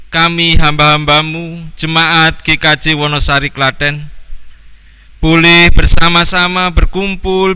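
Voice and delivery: Christmas prayer. Acted. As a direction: pray fervently for the congregation, full voice. Leads congregation in prayer. (doa yang khusyuk dan lantang)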